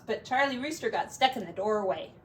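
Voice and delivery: ordinary delivery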